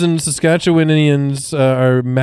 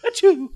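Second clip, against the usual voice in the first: fake sneeze. Car sneezing.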